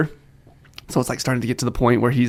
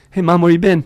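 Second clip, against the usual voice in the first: higher, louder greeting to Mom; imitating young son; 6 semitones higher, 5 dB louder than usual. Son greets mom back from downstairs, imitating young son. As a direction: mimic a little boy calling out. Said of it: "upstairs and be like".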